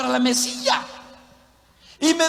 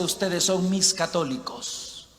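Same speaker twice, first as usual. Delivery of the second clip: lower, softer, slow reading ending on "católicos"; dismissive contrast; 5 semitones lower, 8 dB quieter, slower than usual. Shown as a demonstration. Preacher shows what the verse doesn't say, quiet dismissive contrast. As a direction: drop the voice low for "mis católicos". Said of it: "No dice".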